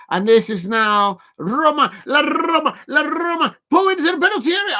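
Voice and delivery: rising, fast play-by-play call; excited commentary. Real, not acted. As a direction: call the attack like live commentary. Live commentary burst.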